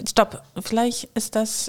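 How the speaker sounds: ordinary delivery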